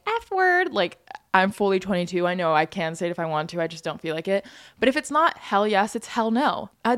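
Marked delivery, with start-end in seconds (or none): 0.1-0.7 put-on kiddie voice, pitched up; childlike. Host who can't swear on the internet, mock childlike voice. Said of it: "sound like a child saying".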